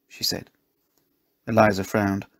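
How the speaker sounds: usual speaking voice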